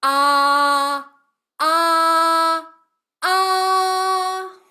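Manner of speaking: three ahs stepping up in pitch; rising ah steps. Shown as a demonstration. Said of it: "So I'll do it again".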